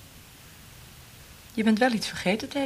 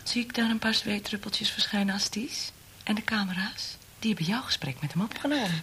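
teasing whisper close to his ear; a mocking, secretive whisper; 3 semitones higher, 6 dB quieter than usual. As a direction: breathe it like a sly threat. Taunting him about hidden cameras.

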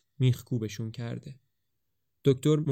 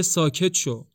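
louder, higher sharp command; angry; 5 semitones higher, 5 dB louder, slower than usual. Acted. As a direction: shut him up for good. (فرمان تند و بلند)

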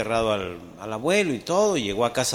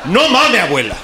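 high, loud laughing disbelief; shocked grandson; 6 semitones higher, 11 dB louder than usual. Acted. Grandson yelps in shock at grandma's confession. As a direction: squeal it out in shock.

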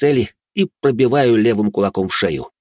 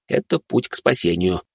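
plain reading voice

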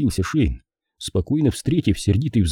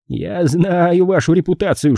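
high-voiced, officious declaration; accusing policeman; 9 semitones higher than usual. A narrator's reading. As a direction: declare it to the detective in a raised pitch. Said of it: "объявил Пейнтер". (полицейский высоким голосом)

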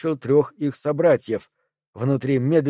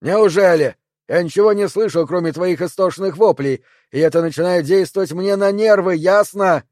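loud, higher furious shouting; angry yell; 5 semitones higher, 4 dB louder than usual. Acted. The furious neighbour yells at Perry about the screaming. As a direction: let him have it. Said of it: "Эл рассердился ещё больше и заорал".

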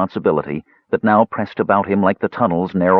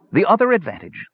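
hollow prattle; 13 semitones higher than usual. Acted. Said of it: "the voice prattled on hollowly".

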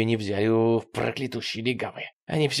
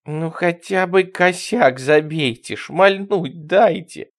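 slow, higher, drawn-out whine; plaintive begging; 5 semitones higher, 5 dB louder, much slower than usual. A narrator's reading. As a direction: plead loudly, stretching the words. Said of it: "жалобно протянула она". (жалобно, протяжно)

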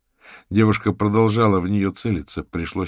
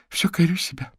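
sighing; 11 semitones higher than usual. A narrator's reading. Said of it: "вздохнула она".

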